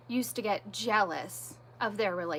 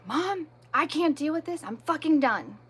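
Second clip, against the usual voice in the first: higher-pitched exasperated teen outburst, retold; retold fed-up daughter; 4 semitones higher than usual. Fifteen-year-old daughter appeals to mom, retold fed-up outburst. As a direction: act out a daughter who has had enough. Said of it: "my daughter got pissed".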